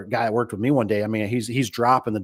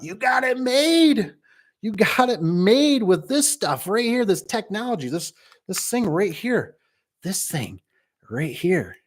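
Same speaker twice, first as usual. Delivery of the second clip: enthusiastic pitch; 9 semitones higher, slower than usual. Real, not acted. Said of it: "pitching phone tech to new guys".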